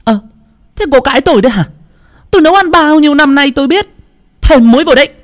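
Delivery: very high, loud, fast scolding; seething mother-in-law. A narrator's reading. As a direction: snap at her to add salt. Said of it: "Bà Tuyết hàm hầm".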